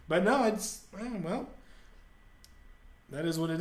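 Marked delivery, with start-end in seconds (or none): none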